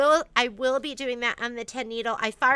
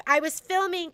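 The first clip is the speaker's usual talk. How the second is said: ordinary talk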